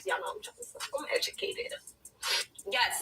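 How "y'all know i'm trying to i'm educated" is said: a hushed joking brag; whispered aside. Real, not acted. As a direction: lean in and whisper it. Mock-proud aside about vocabulary.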